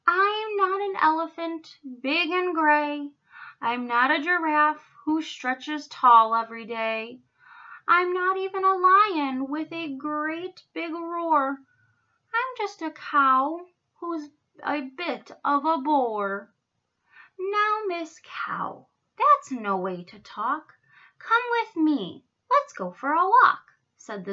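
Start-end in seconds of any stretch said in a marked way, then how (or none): 0.1-16.5 higher, louder plaintive cow; self-pitying cow. A narrator's reading. Miss Moo laments to the zookeeper, self-pitying. 17.4-23.6 lightly voiced, louder zookeeper; encouraging zookeeper. A narrator's reading. Zookeeper invites sad cow along, encouraging.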